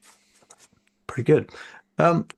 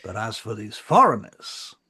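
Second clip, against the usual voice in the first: slow, higher-pitched emphatic oratory; indignant council marshal; 4 semitones higher, slower than usual. Acted. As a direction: defend your own people in stately phrases.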